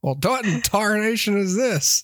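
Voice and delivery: put-on high, creaky old prospector voice. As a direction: cackle it like a grizzled miner. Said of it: "we're like Southern Statler and Waldorf".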